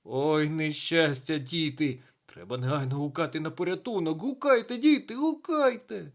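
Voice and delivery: loud lament building to high cries; distraught teacher raising the alarm; frantic wailing. A narrator's reading. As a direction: start wailing, end shouting high for help. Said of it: "лементував він, заламуючи руки".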